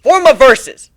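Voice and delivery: loud spell shout. Acted. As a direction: spell cry, high and loud. Casting spell aloud.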